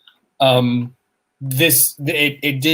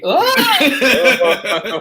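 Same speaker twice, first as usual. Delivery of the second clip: mustache twirling villain; 11 semitones higher, 6 dB louder than usual. Pretend. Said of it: "[name], I wonder if you have an idea".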